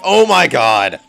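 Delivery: loud burst of panic; mock scream. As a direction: yell it out in frustration. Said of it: "I'll be screaming".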